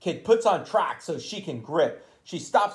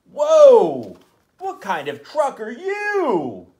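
amazed whoa; 4 semitones higher, 7 dB louder, slower than usual. Acted. Trucks amazed at tiny truck.

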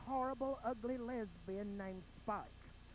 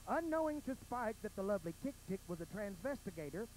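ordinary talk

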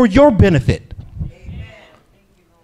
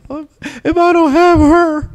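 put-on lovesick teen; 10 semitones higher than usual. Lovesick eighth-grade self quoted.

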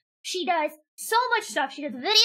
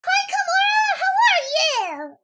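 high, loud squealed hello; excited greeting; 12 semitones higher, 6 dB louder than usual. Real, not acted. Streamer greeting an arriving viewer, excited high shout. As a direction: shout it high and draw out the question.

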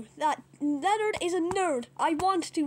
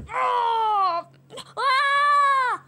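long, loud high cry; acted scream; 6 semitones higher, 7 dB louder than usual. Pig under attack in the fight, screaming loudly.

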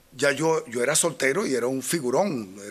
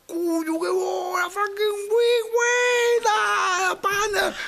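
the mugger suddenly gushing over his idol; high excited fan gush; starstruck robber; much slower than usual. Retold or imitated. Robber recognizes the TV star.

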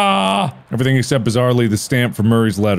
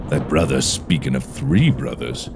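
low, soft, brooding woodsman; low woodcutter voice; 10 semitones lower, 4 dB quieter than usual. Acted. Woodcutter muses over the tree's words, low and soft. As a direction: drop deep and quiet, musing.

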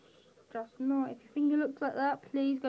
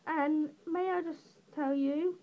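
usual speaking voice

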